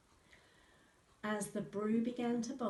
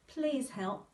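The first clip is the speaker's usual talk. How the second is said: slightly higher, louder plea; begging; 5 semitones higher, 6 dB louder than usual. A narrator's reading. Father begs the wizard to help, pleading. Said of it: "begged her dad".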